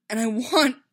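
loud, high, longing break-off. A narrator's reading. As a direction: break off as longing overwhelms the words. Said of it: "his voice broke for a moment with longing".

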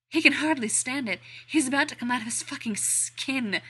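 high, loud, breathless taunt; excited taunt. Acted. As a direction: crow it, barely holding on. Steve taunting, aroused.

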